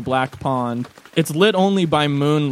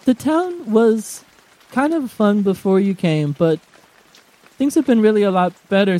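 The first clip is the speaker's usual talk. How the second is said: put-on shy girl voice; 4 semitones higher than usual. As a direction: say it shyly. GM voices Baggy.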